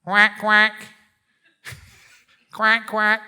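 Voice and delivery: loud, high imitated quacks; duck quack. Monk imitates the sound the couple heard, loud quacking. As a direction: quack out loudly like a bird. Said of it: "they heard this sound".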